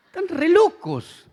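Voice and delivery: loud high laugh through the words. Real, not acted. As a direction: burst out laughing at the fans. Se ríe de los fanáticos. (riendo fuerte, agudo)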